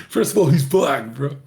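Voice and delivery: cracking up while talking; laughing. Real, not acted. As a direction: blurt it out through real laughter. Cracking up at his own remark.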